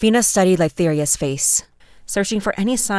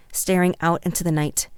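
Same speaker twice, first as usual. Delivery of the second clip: usual speaking voice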